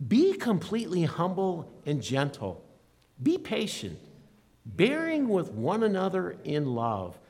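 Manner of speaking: solemn scripture recitation; scripture reading. Acted. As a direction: read the verse out with weight to the room. Reads scripture verse aloud.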